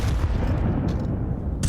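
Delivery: soft giggle. Real, not acted. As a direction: giggle at the silly play. Laughing at the round's end.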